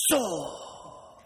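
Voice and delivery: drawn-out mock battle cry, kept soft; Beckett drawing his sword. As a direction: stretch the word like a blade sliding out. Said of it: "and he's going".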